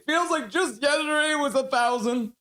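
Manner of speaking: high excited outburst. Real, not acted. Streamer cheering a player's eleven hundredth game, excited shout. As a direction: belt it out in delight.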